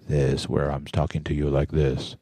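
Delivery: low voice right up on the microphone; deep, intimate close-mic demo. Shown as a demonstration. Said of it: "my normal speaking tone of voice".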